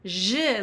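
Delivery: "zh" as in Zhivago; ZH sound. Shown as a demonstration. Teacher showing an accented Z to learners, ZH sound. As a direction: say "zh" as a voiced "sh". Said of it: "like Doctor Zhivago".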